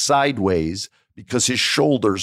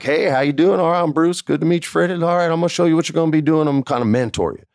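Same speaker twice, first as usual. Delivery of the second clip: put-on Texas good old boy; 7 semitones higher, faster than usual. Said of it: "he's this good old Texas boy".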